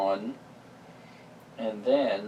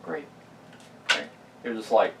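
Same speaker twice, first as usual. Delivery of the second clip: usual speaking voice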